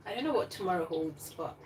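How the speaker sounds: usual speaking voice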